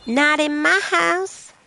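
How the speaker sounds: hammed-up disapproving mum laying down the law; mock strict parent. Cat owner forbids her cat getting pregnant, in a hammed-up strict parent voice.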